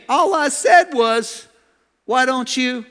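slow, high mock protest; defensive husband. Husband insisting it was one remark, high defensive protest. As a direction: protest innocence, drawn out and high.

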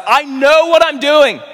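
high, loud, drawn-out cry; impassioned shout. Retold or imitated. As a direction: cry it out with full preaching force. Preacher cries out as Jesus.

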